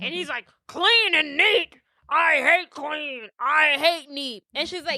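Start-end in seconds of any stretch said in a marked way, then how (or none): 0.7-4.4 slow, higher, lightly voiced grumpy old man; disgusted old grump. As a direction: drag it out like a cranky hermit who hates tidiness. Mean old Gork disgusted.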